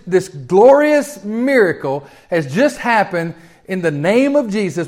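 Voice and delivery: ordinary talk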